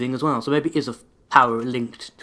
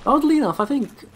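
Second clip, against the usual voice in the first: high pitched; 13 semitones higher, 3 dB louder than usual. Real, not acted. Sudden high exclamation.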